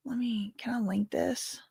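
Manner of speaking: hushed, half-whispered aside; whispering to self. Real, not acted. Streamer checking whether she can link something, whispered. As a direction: whisper it under the breath. Talking to self while linking.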